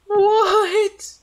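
shrieked, very high startled what; real shocked squeak. Real, not acted. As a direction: jump at something on screen. Startled in a horror game.

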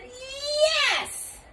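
very high, drawn-out squeal; stubborn retort. A narrator's reading. Cashier insists to the father, stubborn high retort. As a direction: stretch it out very high. Escalating argument.

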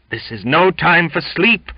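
high, loud, ringing preacher's cry; very loud exclamation. A narrator's reading. Cook rebukes sleepy Jimmy, very loud fervent exclamation. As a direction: thunder it like a fiery preacher. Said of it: "exclaimed the cook very loud".

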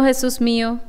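ordinary delivery

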